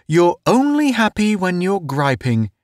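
high-pitched genial ribbing; good-natured. A narrator's reading. Town teasing Pace about griping, good-natured high voice. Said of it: "Town said good-naturedly".

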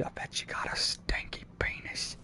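hushed mimicry of an Australian; imitated Australian. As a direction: quote him softly in an Australian accent. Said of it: "He sounded Australian".

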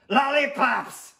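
loud, high, drawn-out yell; blurted shout. Acted. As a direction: bellow the craving across a classroom. Tooth shouts in class.

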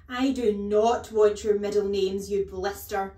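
very loud, slow insult; overbearing headmistress shouting down a pupil; bellowed rebuke. A narrator's reading. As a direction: bellow it word by word. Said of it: "the Gorgon bellowed".

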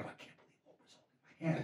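awed whisper to himself. Retold or imitated. Fan beside Oprah at the party, stunned whisper. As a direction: breathe it out so she won't hear. Awestruck while Oprah holds his hand.

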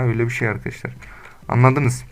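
ordinary talk

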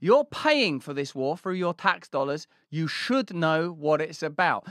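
normal talking voice

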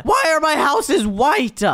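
high, drawn-out cry of dismay; dismayed outburst. Acted. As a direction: wail it slowly in disbelief. Dismayed at changed houses.

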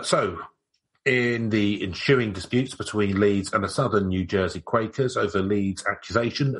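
usual speaking voice

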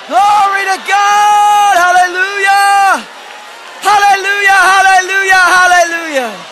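high, full-force shout of praise. Real, not acted. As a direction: shout it out long and high to rouse the church. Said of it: "shout just a little bit".

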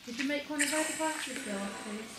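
normal talking voice

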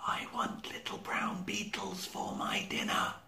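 tiny high whisper; barely heard. Acted. Little egret calling her dinner order, faint high whisper. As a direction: whisper it, almost lost on the breeze. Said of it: "could just be heard above the breeze".

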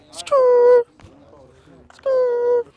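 high loud skrr sound effect; tire screech noise. Retold or imitated. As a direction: mimic a car skidding.

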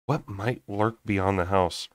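ordinary delivery

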